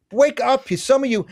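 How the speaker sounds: sudden high-pitched wake-up call to the listeners; urgent call. Real, not acted. Host urging his listeners to wake up, high-pitched and pressing. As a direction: jolt the audience awake with a sharp, high cry.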